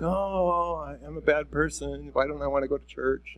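mild, quiet guilt; mopey guilt. Retold or imitated. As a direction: sigh it out like you let yourself down.

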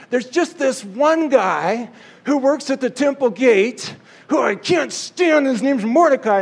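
high, pleading, wheedling Haman; groveling Haman. Acted. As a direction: beg like a weaselly courtier caught out. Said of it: "I imagine it went something like this".

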